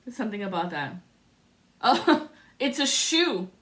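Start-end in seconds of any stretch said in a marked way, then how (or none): 1.8-3.5 laughing. Real, not acted. Laughing surprise at a shoe.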